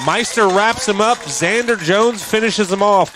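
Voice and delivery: rising, loud play-by-play shout; excited call. Real, not acted. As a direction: call the tackle with a big lift.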